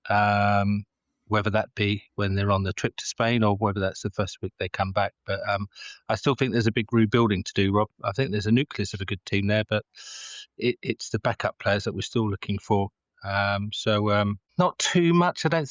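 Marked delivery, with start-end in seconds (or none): none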